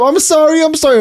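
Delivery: high, frantic apologizing voice; mock apology. Woman caught on the toilet, apologizing in a high panic. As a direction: squeak out a flustered apology. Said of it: "apologizing to everyone".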